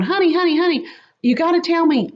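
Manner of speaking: quick, pleading phone questions, mildly acted; a hint of a worried spouse; concerned husband.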